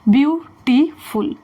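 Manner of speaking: "Beautiful" in three separate slow beats; broken into syllables. Shown as a demonstration. Teacher showing students the word won't fit one syllable, syllable-by-syllable. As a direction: split "beautiful" syllable by syllable with pauses. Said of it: "one syllable में बोल रहे हैं, नहीं बोल पा रहे".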